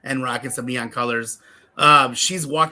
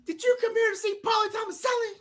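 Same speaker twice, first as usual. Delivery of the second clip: put-on very high kid voice, calling out; little girl's promo. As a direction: mimic a small child cutting a wrestling promo. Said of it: "And then she goes".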